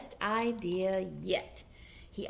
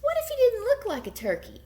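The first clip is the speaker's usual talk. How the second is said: high, fast, eager question; sudden excited brainstorm; excited idea; 13 semitones higher, 5 dB louder, faster than usual. A narrator's reading. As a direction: burst out with the bright idea.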